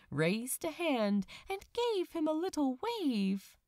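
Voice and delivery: usual speaking voice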